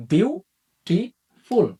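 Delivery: বিউটিফুল stretched very slowly into three syllables. Shown as a demonstration. Teacher to students, stretching বিউটিফুল slowly by syllable. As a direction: pull বিউটিফুল apart slowly, one beat per syllable. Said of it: "আপনারা যদি সাউন্ডের মাধ্যমে বিষয়টা বুঝতে চান".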